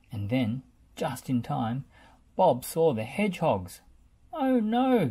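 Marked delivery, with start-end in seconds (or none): 4.3-5.1 louder, higher alarmed gasp. A narrator's reading. Bob spots the hedgehogs and gasps. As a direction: catch your breath in alarm. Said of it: "he gasped".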